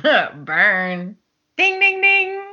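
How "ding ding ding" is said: ding ding. Pretend. Mock game-show bell.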